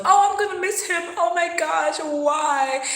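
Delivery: high, whiny mock lament; hammed-up tearful whine; mock heartbreak. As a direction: parody a dramatic heartbroken cry. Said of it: "Not for the reasons of".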